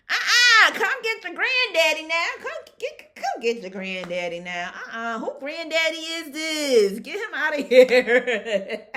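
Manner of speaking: high, loud shooing away; loud dismissal. Retold or imitated. Telling someone to fetch the date, loud mock outrage. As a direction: send him off, laughing loudly. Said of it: "I like".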